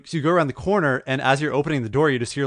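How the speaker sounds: ordinary talk, nothing marked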